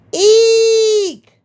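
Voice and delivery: high shrill shriek; ghost shriek. A narrator's reading. Ghost shrieking at the knocking, high and loud. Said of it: "The ghost shrieked".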